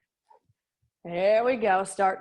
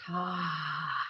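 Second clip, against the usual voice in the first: long, soft breathy sigh; long exhale; 7 semitones lower, 9 dB quieter than usual. Real, not acted. Instructor exhaling through a warm-up, long soft sigh. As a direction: let out a quiet "ah".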